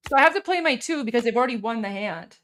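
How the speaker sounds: ordinary talk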